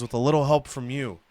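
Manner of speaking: soft, somber charity appeal. Retold or imitated. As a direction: plead gently like a late-night donation ad. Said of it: "like those sad commercials".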